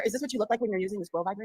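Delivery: usual speaking voice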